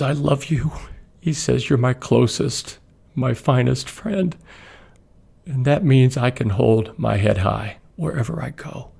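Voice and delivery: fighting back tears while quoting; moved, near tears; choked up. Real, not acted. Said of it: "you'll know why I'm crying".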